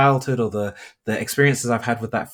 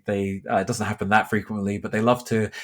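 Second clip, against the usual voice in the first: usual speaking voice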